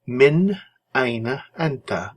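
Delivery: slow, careful Arabic Min aina anta; teacher modelling the question; slow model question. Shown as a demonstration. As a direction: model the question slowly for learners. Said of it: "teacher models question slowly".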